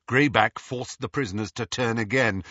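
plain reading voice

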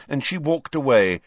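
ordinary delivery

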